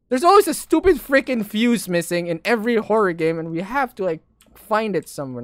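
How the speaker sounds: loud exasperated complaint; annoyed rant. Real, not acted. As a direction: vent your frustration loudly. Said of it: "I'm just ranting right now".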